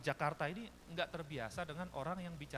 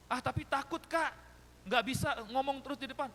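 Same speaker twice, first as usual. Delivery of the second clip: high, loud youngster's objection; imitated nervous protest; 9 semitones higher, 6 dB louder than usual. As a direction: protest in a raised voice like a reluctant teen. Voices a scared teen's protest. (menirukan remaja takut, suara tinggi dan keras)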